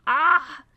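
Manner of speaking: short loud high "ah"; sudden frustrated yell; exasperated scream. Real, not acted. Gamer reacting to the twist in the names, exasperated scream.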